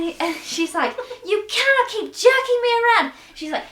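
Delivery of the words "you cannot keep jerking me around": high, loud, fed-up yelling; acted angry shout. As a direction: tell the ex off at full volume. Acting out the break-up scene.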